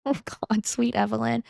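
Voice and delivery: ordinary talk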